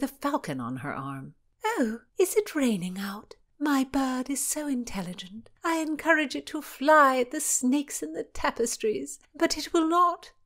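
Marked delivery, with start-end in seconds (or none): 1.6-10.3 high, breathy-light eccentric princess; a dreamy royal lady, half-whispered at first; airy princess. A narrator's reading. Cecilia voiced, airy princess.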